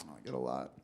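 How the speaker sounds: hushed murmur under the breath; whispered aside. Acted. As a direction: drop to a near whisper. Repeated line, dropped to a whisper.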